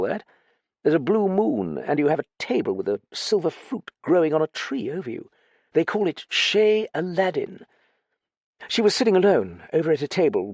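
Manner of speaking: high-pitched, hesitant upper-class chap; flustered young gentleman, reluctant. Acted. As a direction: confess haltingly in a high, fussy voice. Narrator voices Dallings telling his story.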